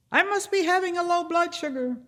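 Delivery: stuck on the rock wall, screaming in panic; panicked scream. Retold or imitated. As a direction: wail it from frozen terror on the rock. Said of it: "and I'm screaming".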